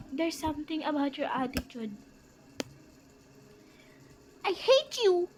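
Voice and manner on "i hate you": bratty yell. Acted. Bratty child yells at yaya.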